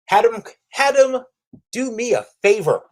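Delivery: normal talking voice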